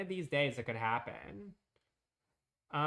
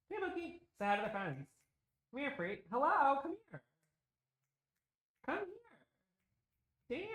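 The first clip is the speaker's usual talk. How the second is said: high sing-song call to the dog; 12 semitones higher, faster than usual. Real, not acted. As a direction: coax the puppy over, way up high. Calling the dog over.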